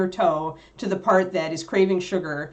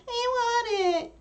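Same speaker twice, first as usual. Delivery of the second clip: high-pitched, drawn-out brat whine; long, squealed childish pleading; whiny child; slower than usual. Acted. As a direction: whine it like an annoying little kid. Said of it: "little whiny child that's really annoying".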